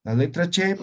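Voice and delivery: usual speaking voice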